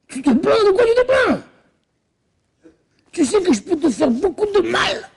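high, scornful taunting; high sneering threat; contemptuous Laban. Acted. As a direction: belittle him, then menace. Laban belittling Jacob.

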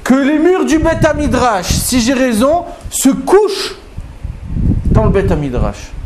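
défi lancé bien plus aigu, en appuyant; Rabbi Eliezer qui defie. Acted. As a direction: proclame le miracle, voix montée très haut. Rabbi Eliezer defie les sages. (Rabbi Eliezer lance son défi aux sages, très aigu)

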